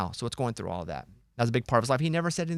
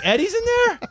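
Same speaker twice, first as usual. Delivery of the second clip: very high, squealing surprise; mock starstruck gasp; 8 dB louder than usual. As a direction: attendant thrilled to hear Eddie's name. Attendant's starstruck reaction.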